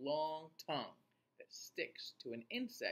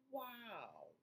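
high soft wow; amazed; 8 semitones higher, 9 dB quieter than usual. Real, not acted. Amazed reaction.

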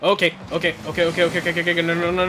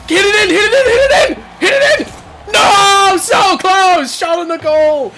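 very high, loud screaming at the play; frantic yelling; 10 semitones higher, 4 dB louder than usual. Real, not acted. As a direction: yell desperately as the shot slips away. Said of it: "I'm sorry for the yelling".

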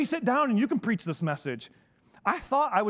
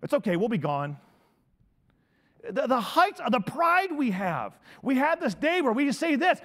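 normal talking voice

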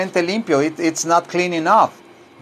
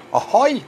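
high, quick swooping cry; cumbia whoop; 7 semitones higher than usual. Acted. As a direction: toss in a festive yip. Cumbia whoop for [name].

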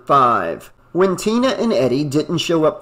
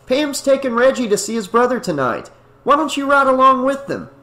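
higher, encouraging; lightly voiced friendly Matt; 9 semitones higher than usual. A narrator's reading. Matt invites Tommy along, higher and friendly. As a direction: coax him to come along.

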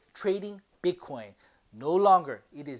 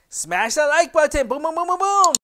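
hype shout; 10 semitones higher, 11 dB louder than usual. Acted. Hyped call to like.

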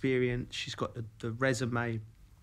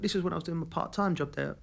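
normal talking voice

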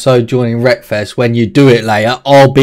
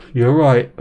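normal talking voice

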